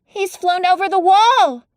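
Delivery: calling out as the robin flies off; excited cry. Acted. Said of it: "Mary cried out".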